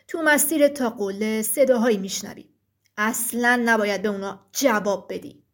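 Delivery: low, heavy giant voice; thick-voiced stone giant. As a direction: speak as a solemn rock giant. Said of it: "غول سنگی با صدای کلفت گفت". (صدای کلفت و بم غول سنگی)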